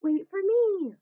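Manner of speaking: calling out. Acted. Benji calls after Dottie zooming down the stairs, loud. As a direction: call out to catch up. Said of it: "called Benji".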